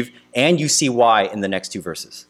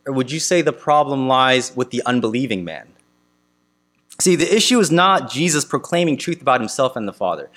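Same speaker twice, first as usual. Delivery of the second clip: normal talking voice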